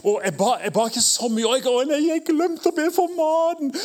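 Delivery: rising to a high mock panic. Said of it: "kristne som går rundt og lurer".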